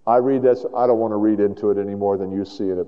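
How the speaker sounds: ordinary delivery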